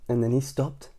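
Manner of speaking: plain reading voice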